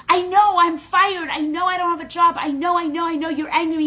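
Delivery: high, loud, tumbling confession; frantic admission. Acted. Rose confesses to her angry cousin, frantic. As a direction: blurt it all out before being scolded.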